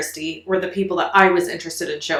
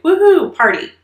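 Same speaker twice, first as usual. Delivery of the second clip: a much higher, louder whoop, then the word; party cheer; 10 semitones higher, 7 dB louder than usual. Retold or imitated. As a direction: celebrate like a kid arriving. Arriving at relatives, celebrating.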